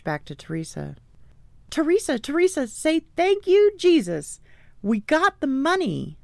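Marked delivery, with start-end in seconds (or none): 1.7-6.2 jubilant announcement. A narrator's reading. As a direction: burst out with wonderful news to a friend. Breaking joyful news to her friend.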